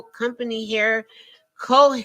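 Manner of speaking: ordinary talk, nothing marked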